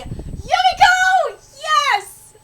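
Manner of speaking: high, loud, stretched-out cheer; triumphant whoop of approval; excited cheering. Real, not acted. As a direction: cheer for your favorite. Cheering on Yumiko.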